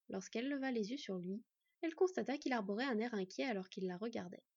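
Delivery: plain reading voice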